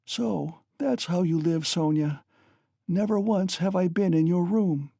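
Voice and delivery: high, weary, bitter dying mother; Katerina, dying. A narrator's reading.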